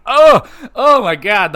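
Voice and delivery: loud, high burst of delight; excited outburst. Real, not acted. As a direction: blurt it out like you just saw the hit. Crowd reaction to low blow.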